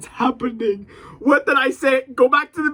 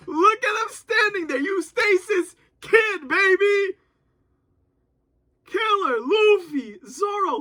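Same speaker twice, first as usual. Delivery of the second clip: higher, excited calling out names; hyped shouting; 8 semitones higher than usual. Real, not acted. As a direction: cheer each hero as they appear. Hyped naming heroes.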